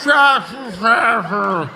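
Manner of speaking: drawn-out slurred ugh, mocking a heckler; mock slurred groan. Comic mocking a heckler's slurred groan. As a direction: stretch it into a dopey groan. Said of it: "you sounded like you just had a fucking stroke".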